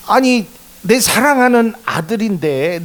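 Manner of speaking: preacher voicing God's indignation; indignant plea. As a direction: stand up for your own child. God defending his child.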